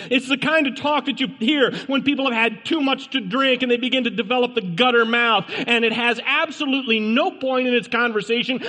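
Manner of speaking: high, emphatic preacher's delivery; full-force preaching. Real, not acted. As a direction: hammer each warning home, pitched high.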